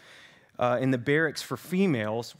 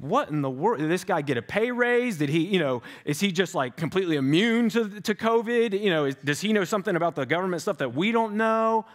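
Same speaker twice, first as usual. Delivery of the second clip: incredulous mock questions, higher; mock skeptic; 5 semitones higher than usual. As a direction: play a baffled skeptic asking.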